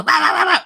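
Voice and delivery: high, loud run of mock barks; high, loud playful barking sounds; repeated bark imitation. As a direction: yap it out like a small dog. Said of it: "She doesn't go".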